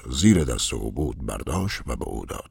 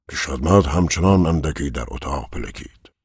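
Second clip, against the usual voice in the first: ordinary delivery, nothing marked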